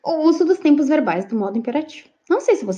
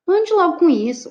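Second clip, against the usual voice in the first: higher, firm demonstrated order; hard command; 6 semitones higher than usual. Shown as a demonstration. Teacher demonstrating one way to give an order, hard command. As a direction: order it firmly, pitched up. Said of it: "Eu posso falar".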